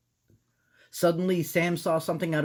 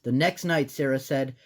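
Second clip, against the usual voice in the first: ordinary delivery, nothing marked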